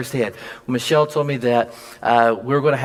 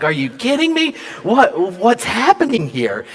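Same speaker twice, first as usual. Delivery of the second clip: shocked; 8 semitones higher, 3 dB louder than usual. Retold or imitated. Retold shock at the plan.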